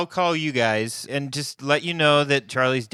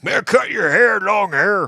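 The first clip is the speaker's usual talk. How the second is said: high, loud cranky farmer voice; hollering old country codger; grumpy old farmer; 8 semitones higher, 5 dB louder than usual. Retold or imitated. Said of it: "old farmer guys being like".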